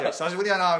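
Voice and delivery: offhand Kansai greeting, spoken up; casual senior's greeting. Acted. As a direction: greet a junior casually, with some volume. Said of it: "だって向こう年下でしょ？".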